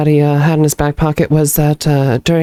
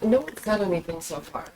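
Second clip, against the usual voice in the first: from another room; 3 semitones higher, 14 dB quieter than usual. Real, not acted. Raven talking from off mic.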